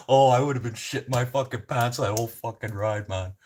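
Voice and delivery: laughing. Real, not acted. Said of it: "I'm laughing".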